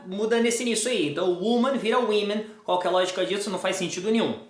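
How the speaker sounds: ordinary delivery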